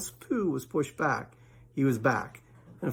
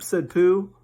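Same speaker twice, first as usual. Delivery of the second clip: plain reading voice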